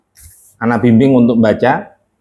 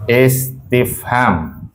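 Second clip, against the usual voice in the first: "istifham" very slow, broken into syllables; 3 dB quieter, much slower than usual. Shown as a demonstration. Teacher dictates a grammar term, very slow "istifham".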